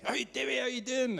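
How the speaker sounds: high, bright imitation of a friendly hello; Chris's cheery greeting. Retelling Chris greeting him at a conference, high and cheery. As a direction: lightly voice Chris calling out a cheerful hi.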